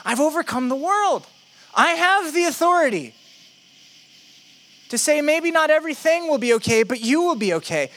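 emphatic promise, pitched high; high, assured declaration; Jesus. Acted. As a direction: promise it with authority, settling at the end. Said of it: "He's promising them".

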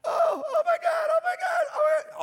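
very high, loud shriek of amazement; retold screaming. Healed grandmother as paralysis leaves her, screaming. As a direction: shriek it out like a suddenly healed woman. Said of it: "she started screaming".

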